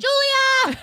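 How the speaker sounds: high, loud call up the stairs; yelling across the room. Real, not acted. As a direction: shout her name to another floor. Calling daughter upstairs.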